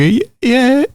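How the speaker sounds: high, strained yeah while fighting tears; holding back tears. Retold or imitated. Boy pretending to like his beanbag present, holding back tears. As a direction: force a yes while trying not to cry. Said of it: "And I'm trying not to cry".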